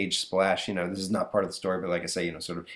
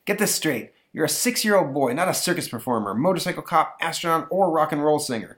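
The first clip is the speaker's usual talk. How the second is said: stern dad; 6 semitones higher than usual. Acted. Father lectures.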